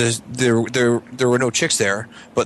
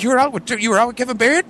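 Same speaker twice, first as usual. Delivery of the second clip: much higher, squeaky surprised question; mock disbelief; 14 semitones higher than usual. Host amazed at who his guest drank with, squeaky surprise. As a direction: jump way up on it, comically amazed.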